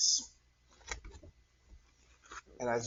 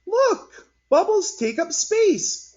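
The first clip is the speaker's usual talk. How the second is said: put-on very high, loud and slow kid's discovery; excited announcement; 6 dB louder, slower than usual. Cory showing friends bubbles, very high and excited. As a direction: announce it like a thrilled little girl. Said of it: "Cory announced".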